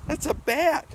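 put-on high teasing kids-show voice; bright high playful question; high excited. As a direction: pitch way up like talking to a small child. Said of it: "kids show voice".

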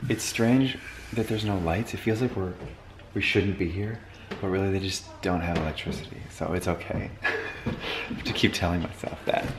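softly, uneasy in the dark house; near whisper. Real, not acted. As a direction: hush it like you're trespassing. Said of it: "so let's be quiet".

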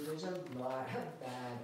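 ordinary talk, nothing marked

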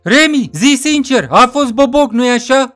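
high, loud character voice; interrogating friend. Acted. Friend interrogating the beaten narrator.

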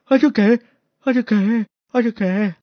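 lightly voiced stammer; stutterer. Kneeling stutterer still trying to speak, stammering. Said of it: "这结巴跪那墙角了".

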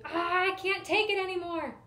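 high, loud exasperated cry; frustrated outburst. Acted. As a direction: throw up your hands and wail it. Boy losing it after crash.

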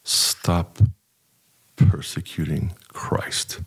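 very slow, grave, hushed warning; stern slow rebuke. Retold or imitated. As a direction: spell out each word slowly like a stern elder. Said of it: "he looks me straight in the eye, and he goes".

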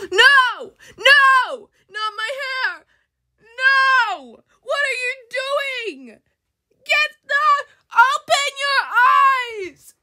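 high shrieking no, full volume; panicked screaming. Acted. Voicing a girl screaming as her hair is cut. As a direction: scream in horror at the haircut.

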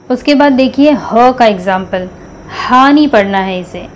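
normal talking voice

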